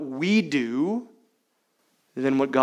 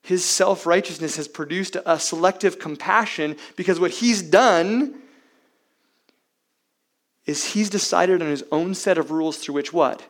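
ordinary talk, nothing marked

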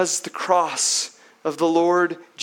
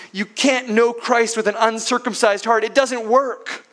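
louder, higher insistence; emphatic preacher's declaration; forceful preaching; 5 semitones higher, 5 dB louder than usual. Acted. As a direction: press the point home hard. Preacher pressing the point.

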